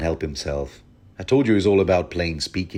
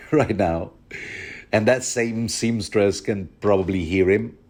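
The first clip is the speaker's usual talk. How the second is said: ordinary talk, nothing marked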